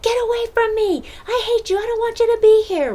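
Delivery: put-on a high, loud angry child lashing out. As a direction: play the furious kid pushing the parent away. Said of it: "they might say things like".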